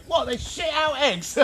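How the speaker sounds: high, slow, incredulous comic voice; Ali G. Retold or imitated. A city lad hearing where eggs come from, incredulous. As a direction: play the clueless city lad, gobsmacked. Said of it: "he's going".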